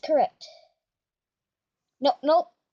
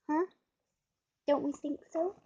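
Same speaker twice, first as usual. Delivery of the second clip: ordinary talk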